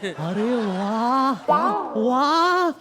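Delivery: delighted wah wah. Acted. Admiring her mimicry.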